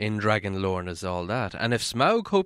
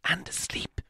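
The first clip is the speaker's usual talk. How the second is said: breathy hushed whisper; whispered thought; 8 semitones higher, 8 dB quieter than usual. A narrator's reading. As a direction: whisper it to yourself in the dark. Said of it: "he thought".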